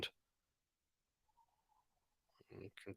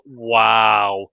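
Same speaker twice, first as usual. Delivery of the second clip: a loud, astonished burst; amazed wow; 9 dB louder than usual. Real, not acted. Commentator stunned by a drop shot, loud wow. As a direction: let the wow jump out at the shot.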